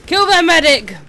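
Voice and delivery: loud, high battle yell; shouted command. Pretend. Player targeting the enemy's medic, shouted command.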